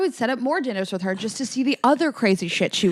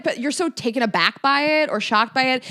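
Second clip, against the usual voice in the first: usual speaking voice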